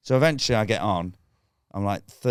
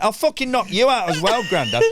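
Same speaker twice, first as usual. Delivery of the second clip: high, indignant comic outburst; mock threat; 10 semitones higher, 4 dB louder than usual. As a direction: round on the old heckler. Acts threatening an old heckler.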